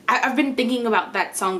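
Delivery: ordinary talk, nothing marked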